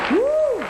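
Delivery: high-pitched "woo"; excited whoop. Real, not acted. Preacher cheering with the congregation, high excited whoop.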